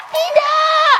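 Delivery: high, loud, hammed-up no; dramatic cry. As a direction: wail it like a soap opera. Character's dramatic refusal. (teriakan dramatis yang tinggi dan keras)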